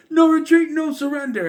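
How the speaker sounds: very high, loud rallying cry; shout. Retold or imitated. R J's one shout that turns the fight, shouting. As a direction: shout it like a fight-turning slogan. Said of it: "just one shout from R J".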